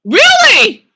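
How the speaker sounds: high, loud excited outburst. Real, not acted. Reacting to a viewer's chat message, excited and loud. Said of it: "I get a little bit excited".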